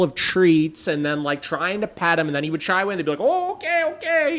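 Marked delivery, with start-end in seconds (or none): 3.2-4.4 much higher, soothing okay okay; imitating the vet's cooing; high mock backing-off. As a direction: back off sweetly, pitched up. Staff backing off from dog.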